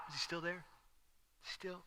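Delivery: very soft, furtive question; barely audible, secretive murmur; hushed peeking soldier. Acted. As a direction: ask it under your breath. Soldiers peeking out, fearful hushed talk.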